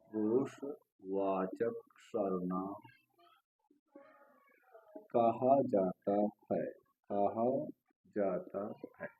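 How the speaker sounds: soft, slow words with long pauses; drawn-out phrases, word by word; slow dictation. Real, not acted. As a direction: dictate quietly while writing.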